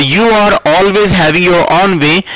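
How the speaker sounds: very slow, every word laid out; slow dictation pace. Shown as a demonstration. Teacher to students, slowly modelling the sentence. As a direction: spell the sentence out slowly for the class. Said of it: "teacher models sentence slowly".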